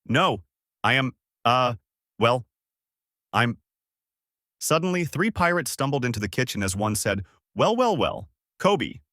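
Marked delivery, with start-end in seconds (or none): 0.0-3.6 squeezed-out words with gaps between; halting, nervous stammer. A narrator's reading. Paralyzed boy answering pirates in the galley, halting stammer. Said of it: "squeezed some words out and said". 7.5-9.0 mock menace. A narrator's reading. Pirate confronts cowering boy in story.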